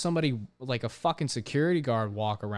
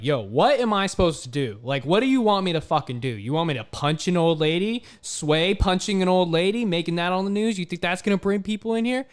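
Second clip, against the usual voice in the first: loud heated retort; retold angry rant; 7 semitones higher, 7 dB louder than usual. Worker to new manager, loud heated retort. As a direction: snap back at the boss, fed up. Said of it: "I flipped on him".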